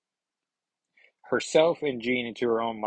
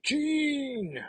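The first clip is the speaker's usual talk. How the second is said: high, drawn-out mock cry of a name; hammed-up long, high wail; mock calling out. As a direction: call it out high and long, at ordinary volume. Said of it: "Scott's like".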